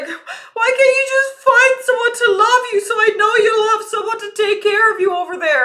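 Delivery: put-on high, loud, tearful wail of a drunk mother; drunk crying mom. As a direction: cry it out loud, pleading and tipsy. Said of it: "she was drunk crying".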